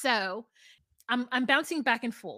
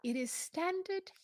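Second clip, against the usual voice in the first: standard British; 6 semitones higher, 12 dB quieter, slower than usual. Shown as a demonstration. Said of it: "So which one is the answer?".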